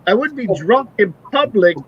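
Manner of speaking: imitated comedian. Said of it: "And he's like".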